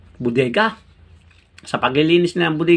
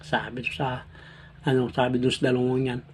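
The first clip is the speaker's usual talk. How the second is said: ordinary talk, nothing marked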